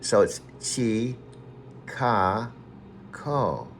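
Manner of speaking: chi, ka, ko split into slow separate syllables. Shown as a demonstration. Explaining the name again, slow careful syllables. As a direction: break chi, ka, ko apart for a learner. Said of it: "the way to do it".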